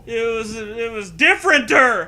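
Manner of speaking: high, loud silly exclamation; mock shock. Narrator joining the joke with goofy alarm. Joking escalation.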